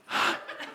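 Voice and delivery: imitated wordless gasp; sharp, breath-only gasp; recognition gasp. Imitating wife gasping at the woman.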